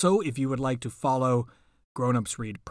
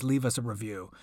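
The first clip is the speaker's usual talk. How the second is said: ordinary delivery, nothing marked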